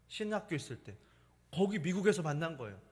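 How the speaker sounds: ordinary delivery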